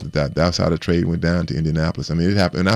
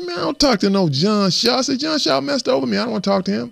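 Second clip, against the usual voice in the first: pitched way up, indignant retelling of his own refusal; mock angry retort. Telling his friend he won't talk to the man, in an indignant high retort. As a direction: scoff at the idea of calling the GM.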